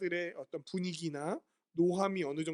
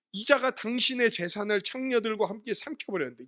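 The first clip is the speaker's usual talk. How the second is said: louder and higher, indignant complaint; angry retort; 5 semitones higher, 4 dB louder than usual. Retold or imitated. Elder son railing at his father over his brother, angry. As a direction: spit out 이자가 with contempt. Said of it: "막 화를 내는 거예요".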